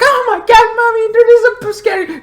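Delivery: put-on very high, loud frightened kid voice; scared child. Imitating a horror movie child running to mommy, squealing. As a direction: squeal like a terrified little kid. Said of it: "and like".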